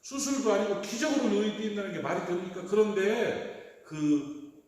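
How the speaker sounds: normal talking voice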